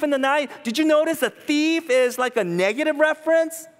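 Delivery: high, loud driving sermon; preaching at full force. Real, not acted. Preacher warning the church about the thief, at full force. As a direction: thunder the warning at them.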